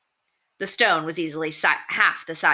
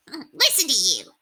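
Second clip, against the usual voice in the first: high rat, amused and teasing; 7 semitones higher than usual. A narrator's reading. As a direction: poke fun at him. Rat character voice.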